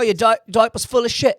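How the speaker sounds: light put-on British parent voice; cooing at a baby in British; British accent. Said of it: "talk to our daughter in a British accent".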